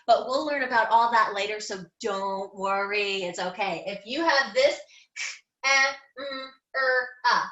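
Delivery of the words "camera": "camera" broken into separate sounds, a, mm, er, ah; sounded out. Shown as a demonstration. Teacher sounding out "camera" for young pupils, sound by sound. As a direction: stretch "camera" out sound by sound with gaps. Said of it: "sand out the words".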